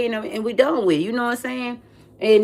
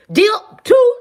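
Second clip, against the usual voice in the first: excited squeal; 14 semitones higher, 6 dB louder than usual. Retold or imitated. High squeal about leaving.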